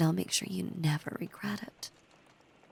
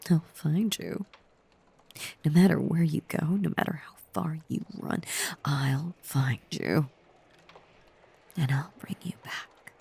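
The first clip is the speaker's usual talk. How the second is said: possessive threat; 6 dB louder than usual. Acted. Obsessed lover warning captive against running, intense and louder. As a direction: press the threat, then draw out the last promise.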